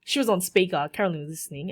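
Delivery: ordinary delivery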